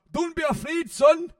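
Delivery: dad's voice, high and loud, mock-calm; imitating dad. Dad collapsing on the golf course reassures his son, high mock voice. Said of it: "he said to Peter".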